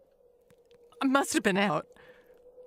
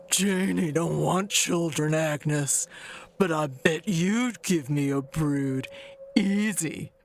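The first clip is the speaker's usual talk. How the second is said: quoting Otis; 3 semitones lower than usual. Retold or imitated. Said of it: "Otis used to say".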